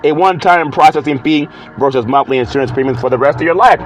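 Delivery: ordinary delivery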